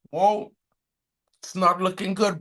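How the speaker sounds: ordinary talk, nothing marked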